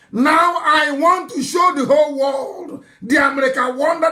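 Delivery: loud, high-pitched declaration to the crowd; a preacher thundering from the pulpit; rallying shout. Real, not acted. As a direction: proclaim it to the whole world. Preacher rallying his audience.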